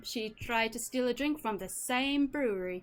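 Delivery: put-on wavering, amateur Irish accent; cheerful Irish-accented halfling reminiscing. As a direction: spin a tale in a loose Irish accent. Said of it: "I'm trying my best to do an Irish accent".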